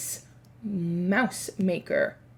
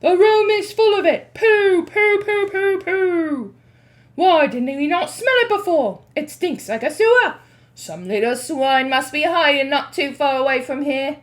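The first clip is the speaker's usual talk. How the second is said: disgusted witches; 6 semitones higher, 8 dB louder than usual. Acted.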